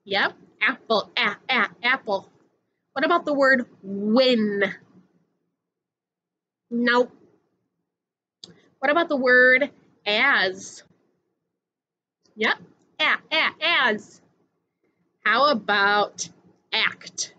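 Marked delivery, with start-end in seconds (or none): 1.2-2.3 short "ah, ah" then the whole word "apple"; first sound isolated. Shown as a demonstration. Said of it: "start with the ah sound". 13.0-14.2 short "ah, ah" then the whole word "as"; first sound isolated. Shown as a demonstration. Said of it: "start with the ah sound".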